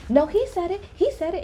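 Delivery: high-pitched imitation of someone butting in; mock insistence. Woman jumping into another couple's argument, mock high insistence.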